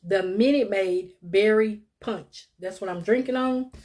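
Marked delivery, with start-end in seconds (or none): 0.0-2.5 slow, careful and clear. Shown as a demonstration. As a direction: spell the name out slowly. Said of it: "Let me slow down".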